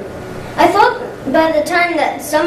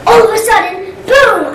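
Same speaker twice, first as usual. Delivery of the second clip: loud, high burst of storytelling; spring the surprise, punching out the boom; sudden excited jump in pitch and volume; dramatic climax; 7 semitones higher, 6 dB louder than usual. Acted.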